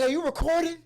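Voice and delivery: hammed-up indignant command; mock alarmed demand. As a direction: play a startled partygoer ordering someone. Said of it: "That's what they would say".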